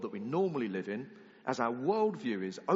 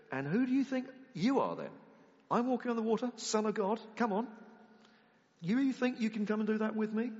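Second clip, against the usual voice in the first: higher, louder scornful taunt; mock scornful taunt; 10 semitones higher, 3 dB louder than usual. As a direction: scoff at Peter as if he is a fool. Jesus scoffing at Peter, imagined.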